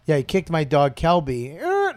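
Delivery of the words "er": sudden high-pitched imitated yelp; dog yelp. Telling how his dog got kicked, imitated yelp. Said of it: "I heard the yelp".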